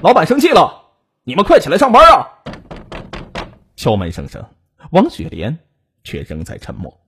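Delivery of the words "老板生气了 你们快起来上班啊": high, loud call through a door; raised, irritated yell to hurry them up; annoyed shouting. A narrator's reading. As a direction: holler impatiently at sleeping coworkers. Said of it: "没好气地喊他们".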